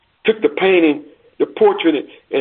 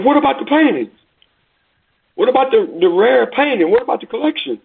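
much higher, outraged crowd questions; indignant protest; 9 semitones higher than usual. Acted. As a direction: demand it, incredulous. Crowd protesting the ended auction.

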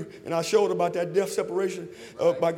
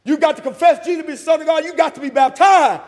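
high, loud, driving sermon delivery; full-force preaching; 9 semitones higher, 10 dB louder than usual. Real, not acted. Preacher telling of his sister's conversion, full-force preaching. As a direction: preach it hard, pitched high throughout.

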